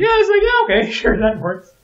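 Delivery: very high, loud squeal; high, laughing send-up; mocking agreement. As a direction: squeal it in amused mock agreement. Mimics garbled film speech, amused.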